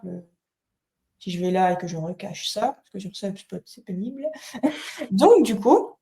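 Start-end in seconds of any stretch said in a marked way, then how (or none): 4.3-5.1 soft, higher-pitched little laugh; brief quiet chuckle at one's own grumble; short chuckle. Real, not acted. Laughing at tool being a pain.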